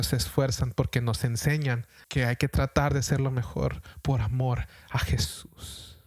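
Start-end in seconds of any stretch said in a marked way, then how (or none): 4.0-6.0 trailing into a whisper. Real, not acted. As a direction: let the last words fall to a whisper. Preacher closes thought softly.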